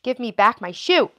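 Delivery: put-on a touch of an angry girl demanding her shoe; lightly voiced angry demand; angry child. A narrator's reading. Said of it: "grew angry and said".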